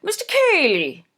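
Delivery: high, loud gushing society mother; gushing Mrs Norbury. A narrator's reading. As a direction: voice her high and effusive, a fussy mother. Narrator voices Mrs Norbury.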